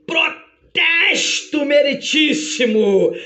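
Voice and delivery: high, hugely drawn-out courtroom objection; ringing, slow cry of protest; advocate's objection. Acted. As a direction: stretch it out like a dramatic interruption. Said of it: "ouve-se uma voz e diz".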